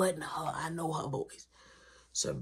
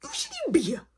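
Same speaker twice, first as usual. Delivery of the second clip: "You shouldn't even be here" said much higher; more heated; 3 dB louder, faster than usual. Retold or imitated. Scolding his friend at the door, the repeat pitched much higher. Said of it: "I'm still heated".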